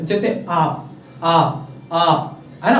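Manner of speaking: आप stretched out very long and slow; long drawn sound. Shown as a demonstration. Teacher to students, showing a long vowel by drawing out आप slowly. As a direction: drag out the आ in आप, slowly. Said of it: "जब ज़्यादा ख-, खींचते हैं".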